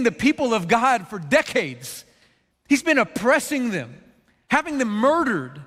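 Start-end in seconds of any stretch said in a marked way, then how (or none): none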